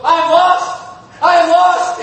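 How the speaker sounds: high, loud cry of a lost soul; screamed cry. Retold or imitated. Organist cries out in church, desperate scream. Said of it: "screamed out".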